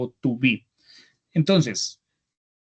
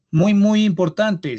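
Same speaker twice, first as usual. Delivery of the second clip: slow and louder, leaning on "muy, muy importante"; emphatic warning; 5 semitones higher, 6 dB louder, slower than usual. Real, not acted. Teacher warning the class about question marks, slow and emphatic. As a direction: stress "muy, muy importante" so the class takes note. Teacher stressing a key rule.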